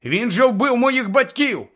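indignant protest, firm and emphatic; Harry, indignant. Acted. Pupil protests about his parents' killer, indignant. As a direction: object as if deeply insulted. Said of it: "обурився Гаррі".

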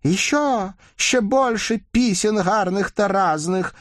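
high, shrill demanding cries. A narrator's reading. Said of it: "закричали все".

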